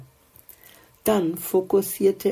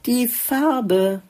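flower's reveal; 7 semitones higher, 3 dB louder than usual. A narrator's reading.

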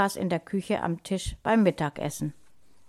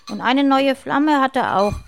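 put-on loud, raised-pitch boyish outburst; blurted out; 5 semitones higher, 7 dB louder than usual. A narrator's reading. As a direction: let it slip loudly. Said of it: "entfuhr es Lukas".

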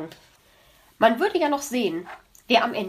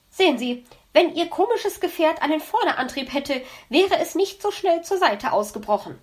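lightly voiced know-it-all magpie; 7 semitones higher, 3 dB louder than usual. Magpie lectures fox smugly.

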